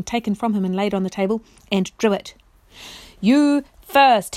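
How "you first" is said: loud, higher battle cry; fierce cry. Acted. Freed knight attacking the chair, loud fierce cry. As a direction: strike with the words. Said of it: "he cried".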